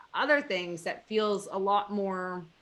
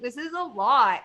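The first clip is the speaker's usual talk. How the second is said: usual speaking voice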